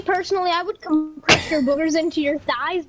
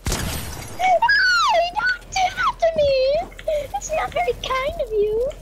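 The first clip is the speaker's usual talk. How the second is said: very high squeaky whine; squeaky cartoon voice; 12 semitones higher, slower than usual. Squeaky put-on complaint.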